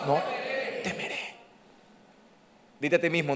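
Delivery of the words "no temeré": whispered. Shown as a demonstration. Pastor ending the group reading in a whisper. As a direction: whisper the last words. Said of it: "Uno, dos y tres".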